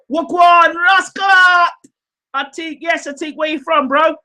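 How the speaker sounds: high, loud Caribbean-style shout of Wagwan Rascal; Caribbean greeting. Pretend. Host greeting a viewer in a mock Caribbean accent, shouted. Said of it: "speaking a bit of Caribbean stuff".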